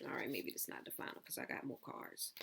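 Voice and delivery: quick unvoiced aside to herself; whispered aside. Real, not acted. Reader correcting herself mid-reading, whispering. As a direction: drop to a whisper as she takes it back.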